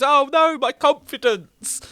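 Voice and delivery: high, strained cartoon wail; a high, slowed-down cry from a beaten boss; mock wail. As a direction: squeal it like a defeated boss. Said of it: "and he goes".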